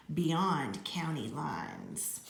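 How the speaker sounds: ordinary delivery, nothing marked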